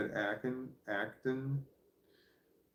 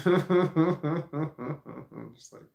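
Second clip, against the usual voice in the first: laughing; 5 semitones higher, 5 dB louder, much slower than usual. Real, not acted.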